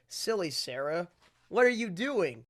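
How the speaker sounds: ordinary talk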